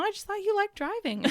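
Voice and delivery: high-pitched innocent reply, retold; naive retort. Wife explaining why husband always drives, high mock-naive reply. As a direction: answer husband in a high, clueless voice.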